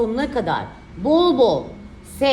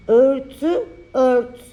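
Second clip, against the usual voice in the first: dictating; 4 dB louder, much slower than usual. Shown as a demonstration. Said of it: "dikte çalışması".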